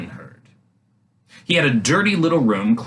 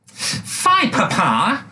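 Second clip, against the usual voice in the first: princess's voice; 9 semitones higher, much slower than usual. A narrator's reading. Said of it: "she said".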